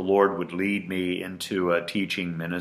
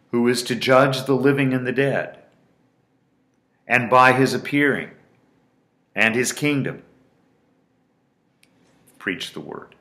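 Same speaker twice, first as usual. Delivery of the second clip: solemn scripture reading; 4 semitones higher, 3 dB louder than usual. Acted. Preacher reading Paul's charge to Timothy, solemn and building. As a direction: read the charge with rising force.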